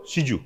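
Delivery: রিজু said as সিজু, with an s; S replacing R. Shown as a demonstration. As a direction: swap the R of রিজু for an s. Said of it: "স বলবে".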